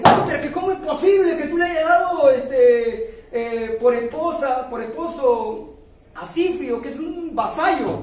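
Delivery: loud, high, outraged demand at the king; furious accusation. Acted. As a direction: hurl the question at him in a rage. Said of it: "se llena de ira".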